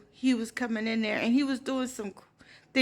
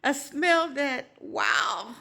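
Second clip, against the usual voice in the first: normal talking voice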